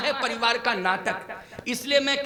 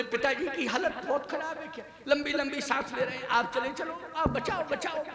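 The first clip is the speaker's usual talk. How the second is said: alarmed family; 3 semitones higher, 8 dB quieter than usual. Retold or imitated. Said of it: "बोले".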